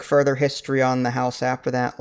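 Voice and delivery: ordinary talk, nothing marked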